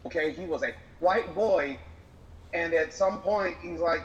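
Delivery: usual speaking voice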